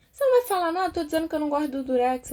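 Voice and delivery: high, loud demand for a response; mock indignant complaint. Complainer nagging the silent listener about tape, mock indignant. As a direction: push it out high, an imitated griper.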